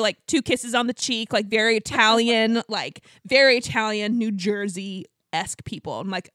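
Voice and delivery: ordinary talk, nothing marked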